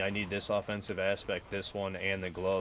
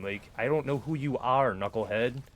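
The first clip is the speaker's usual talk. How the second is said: angry; 4 semitones higher, 5 dB louder than usual. Real, not acted. Angry at random messager.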